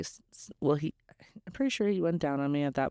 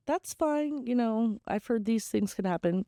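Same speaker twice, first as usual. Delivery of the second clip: high, soothing reassurance; reassuring; 8 semitones higher than usual. Retold or imitated. Comforting the sobbing man in bed, high and reassuring. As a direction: comfort him gently, voice lifted high.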